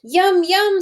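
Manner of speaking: put-on high, excited little kids' voice; eager children. Teacher voicing the children tasting, high and eager. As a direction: squeal it like happy children.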